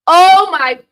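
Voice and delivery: loud, high exclamation; exasperated outcry. Real, not acted. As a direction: cry out in disbelief. Reacting to bad play.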